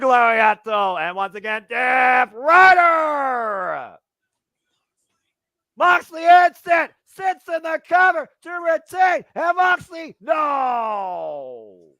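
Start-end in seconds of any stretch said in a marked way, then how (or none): 1.7-4.0 drawn-out shout. Real, not acted. Calling the finisher name. 5.8-11.9 high, shouted near-fall call; excited call. Real, not acted.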